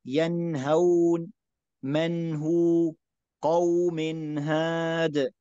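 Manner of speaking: ينهون very slow, nun clear before the ha; correct izhar. Shown as a demonstration. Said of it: "النون مظهرة". (تلاوة بطيئة بإظهار النون)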